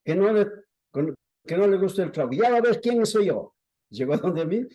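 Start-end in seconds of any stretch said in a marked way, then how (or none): 0.0-3.5 high, raised voice picking someone out; mock yell of a threat; imitated shouting. Woman threatening the passenger who refuses liquor, imitated shouting. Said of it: "empezó a gritar".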